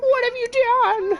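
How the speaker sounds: very high, loud drawn-out mock wail; distraught game character. Streamer mimicking a favorite game line, hammed-up distraught wail. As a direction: wail it like a distraught boy in falsetto. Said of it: "he sounds so distraught".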